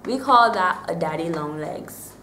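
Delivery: ordinary talk, nothing marked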